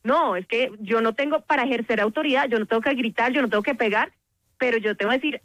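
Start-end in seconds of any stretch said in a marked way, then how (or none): none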